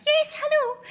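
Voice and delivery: very high, loud comic squeak; high-pitched nervous voice. Retold or imitated. Starstruck woman answering Sean Connery's call, exaggerated high-pitched nervous voice. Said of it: "my voice goes really high-pitched".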